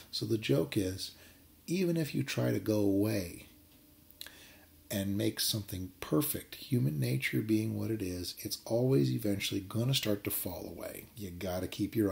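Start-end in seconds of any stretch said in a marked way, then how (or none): none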